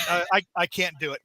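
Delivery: ordinary talk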